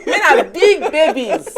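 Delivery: loud, high laughing taunt; laughing jeer. Real, not acted. Teasing the man about being a baby, laughing jeer. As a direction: crow it out through laughter.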